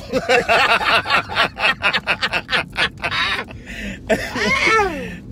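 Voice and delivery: loud rolling cackle; real laughter taking over; loud laughing fit; long loud laugh. Real, not acted. Cracks up over miserable girls.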